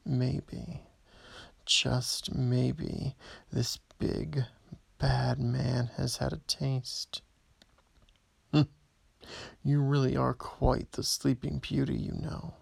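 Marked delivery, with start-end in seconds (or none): none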